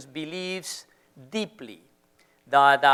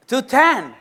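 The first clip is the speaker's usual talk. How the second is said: echoing the audience; 8 semitones higher, 6 dB louder than usual. Retold or imitated. Echoes audience remark.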